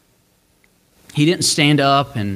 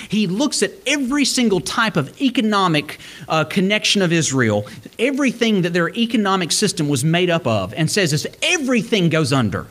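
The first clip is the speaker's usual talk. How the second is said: raised, fervent preaching voice; 6 semitones higher than usual. Acted. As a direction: lift the pitch high and press the message. Passionate preaching run.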